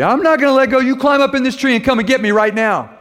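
frightened shout. Retold or imitated. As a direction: scream it, clinging to the branch. Nephew refuses to let go.

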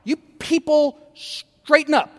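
mock preacher telling the pews to straighten up; preachy scolding. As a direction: wag a finger at the congregation in a hammed-up preacher voice. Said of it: "we get all preachy".

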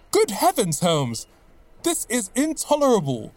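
high, indignant cry of shock; shocked outburst. Acted. Watson, told the rooms were set on fire, cries out in high shock. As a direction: burst out, appalled at the news.

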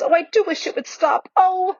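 put-on high, anxious child's plea; worried wish ending in a high "oh"; distressed plea. A narrator's reading. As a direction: plead in distress, rising to a cry. Susan distressed by the pulling.

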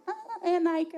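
high-pitched imitation of a child; little niece's voice. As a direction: answer like an excited little kid. Voices young niece answering her.

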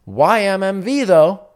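loud, high stress on Y M M V; forum reply punchline. Acted. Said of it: "maybe someone else answers".

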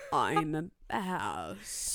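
very slow, drawn out; slow, low-key pun delivery; slow deadpan. Acted. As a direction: drag it out, pun style.

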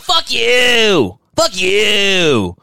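high, loud mock shout; cat yelling. Voicing the cat snapping at its owner, loud. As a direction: yell it like an angry cat. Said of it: "My cat's like".

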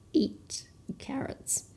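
slow teasing whisper. Acted. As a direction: whisper it slowly to the kids. Hint about the fox.

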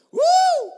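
very high, loud whoop; joyful whoop. Real, not acted. Pastor cheers with the congregation, a loud high whoop. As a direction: let out a cheer.

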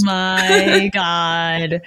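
drawn out, laughing through it; stunned laugh at the brutal scene; slow laughing oh my God. Real, not acted. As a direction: stretch it out slowly while laughing. Reacting to shocking violence.

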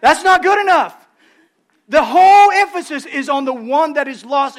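loud, high insistence; preacher crying out the point; emphatic preaching. Real, not acted. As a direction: hammer the point home. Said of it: "emphatic sermon point".

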